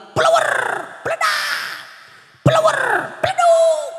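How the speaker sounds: high, sharp mock thuds of flying stones; comic imitation of stones pelting the Prophet; stone-throw sounds. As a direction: fling out each sound like a stone hitting.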